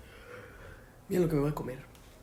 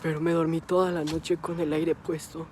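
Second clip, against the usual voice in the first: louder hoarse sick voice; 9 dB louder than usual. Real, not acted. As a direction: strain through a sore throat. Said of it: "mi voz ya se escucha un poquito mejor".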